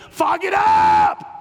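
slow shouted fog it up; shouted slowly. Acted. Pastor mocking an excuse, shouting slowly. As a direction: bellow the phrase, drawn out. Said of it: "I said F O G it up".

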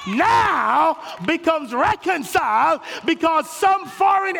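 full-force preaching. Acted. Pastor preaching reconciliation to the church, shouting. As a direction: lift the congregation with rising shouts.

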